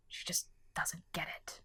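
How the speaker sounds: slow, hushed whisper; whispered aside. Acted. Narrator confiding privately about her companion, whispered aside. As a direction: whisper it to yourself in exasperation.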